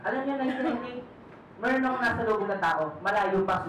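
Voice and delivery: ordinary delivery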